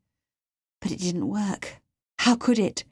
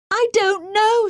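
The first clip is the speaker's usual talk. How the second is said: high, loud, drawn-out wail; desperate cry; 14 semitones higher, 5 dB louder, slower than usual. A narrator's reading. Captive pressed by the man questioning her, desperate cry. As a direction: cry it out slowly, pitch right up. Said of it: "she cried".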